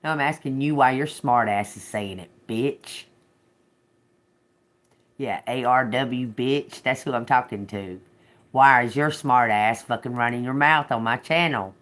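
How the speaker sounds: real irritated telling-off; heated challenge, forceful and indignant; angry confrontation. Real, not acted. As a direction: confront the critic, pushing harder as you go. Telling off a critical commenter.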